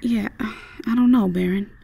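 usual speaking voice

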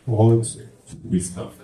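usual speaking voice

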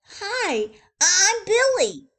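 put-on high, loud eager boy. Billy introducing himself to the zookeeper, high and loud. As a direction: introduce yourself brightly. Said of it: "he said to the zookeeper".

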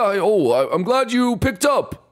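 put-on high, hearty old-friend voice; Egad, jovial caller. Professor phoning his boy Luigi, jovial. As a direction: greet the lad with gusto.

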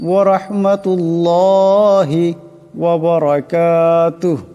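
measured, chanted recitation of the tashahhud; drawn-out devotional chant; tajweed recitation. Shown as a demonstration. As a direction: recite it slowly and melodically, as in prayer.

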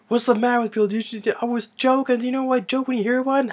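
high, loud mock panic; freaked-out panic. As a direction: imitate frantic pleading to be let out. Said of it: "he gets freaked out".

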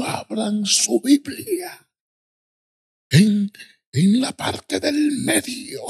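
high, drawn-out preacher cry; imitated preacher. Preacher calling the crowd to open their Bibles, high slow imitation. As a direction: stretch it out like a fiery open-air preacher. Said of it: "así hablaba el hombre".

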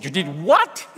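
what rising in disbelief; you did what? with a shocked rise. Retold or imitated. Censors hearing about the joke, shocked rise. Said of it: "they were shocked".

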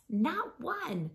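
plain reading voice

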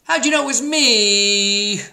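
high, loud, drawn-out turkey howl; hammed-up howl. A narrator's reading. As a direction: howl it like a cartoon turkey found out. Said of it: "howled Turkey".

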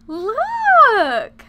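very loud, high, drawn-out squeal; amazed squeal. Real, not acted. Player points out his face, amazed squeal. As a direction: shriek it long, pointing it out to everyone.

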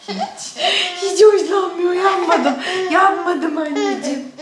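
high, laughing coo. Real, not acted. Laughing baby talk to fussing son.

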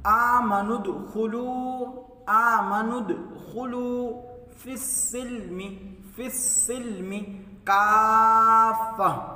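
slow, drawn-out tajweed chant; chanted Quranic recitation. Shown as a demonstration. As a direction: recite melodically, stretching the vowels. Recites verse word by word.

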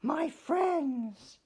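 high, loud little-fish voice; Stanley thrilled about his friends, high and loud; little fish excited. Acted. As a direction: burst out as tiny Stanley.

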